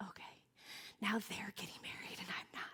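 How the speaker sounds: breathy whisper, barely voiced; whispered ouch. Admitting to the audience it hurt, in a whisper. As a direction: hush it like a private hurt. Retelling her inner complaint.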